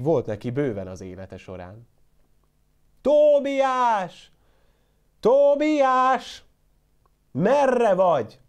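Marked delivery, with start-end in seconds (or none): 3.0-8.4 high, loud calling for the goat; old shepherd hollering for his goat. A narrator's reading. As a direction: shout across the yard, drawn out. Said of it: "kurjongatott nagyokat".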